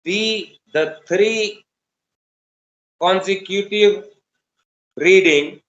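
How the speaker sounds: slow, spaced dictation pace; dictating. Shown as a demonstration. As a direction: read it out word by word for writing. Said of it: "dictation to class".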